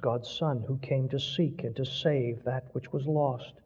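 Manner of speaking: plain reading voice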